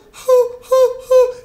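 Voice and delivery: high, loud falsetto hoo; falsetto hoots. Shown as a demonstration. Coach showing anyone can hoot in falsetto. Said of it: "Everybody can go".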